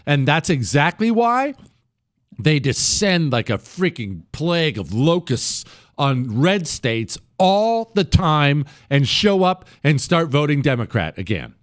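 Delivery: ordinary talk, nothing marked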